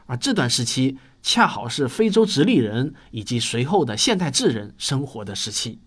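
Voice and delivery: ordinary delivery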